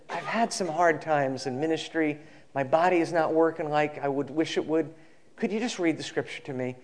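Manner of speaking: softly voiced, retold confession; subdued request. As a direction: ask quietly, as if admitting hard times. Retold weary preacher asks a favor.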